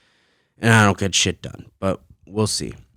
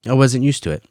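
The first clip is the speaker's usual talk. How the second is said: ordinary talk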